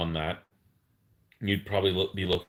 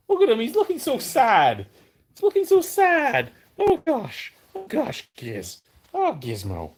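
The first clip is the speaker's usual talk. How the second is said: talking to a dog; 5 dB louder than usual. Real, not acted. Pitying dog struggling.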